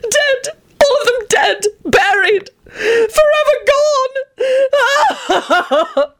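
very high, drawn-out wailing lament; theatrical anguish. Acted. Antonin mourns dead mother and sisters, high theatrical wailing. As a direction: wail each phrase with hammed-up anguish. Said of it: "high-pitched and heartrending scream".

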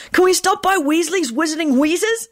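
put-on high, eager child's call; happy shout. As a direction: bounce it out, thrilled. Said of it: "Timothy shouted happily".

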